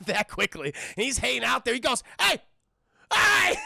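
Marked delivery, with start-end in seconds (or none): 2.2-3.6 high, loud yell across the distance; angry shout. Retold or imitated. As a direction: bark it to stop him. Tuco yelling at Blondie.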